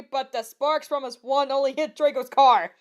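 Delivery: plain reading voice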